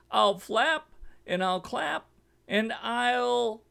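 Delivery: loud, high squawk cut off mid-threat; squawking eagle. A narrator's reading. Eagle squawking down the chimney before the fire hits. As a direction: squawk it, then break off sharply. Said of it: "eagle threatens in chimney".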